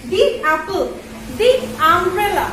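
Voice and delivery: the ice cream said very slowly, the as thee; slow demo. Shown as a demonstration. Teacher drawing out the ice cream slowly for students. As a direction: spell it out slowly for the class. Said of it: "উচ্চারণ হবে".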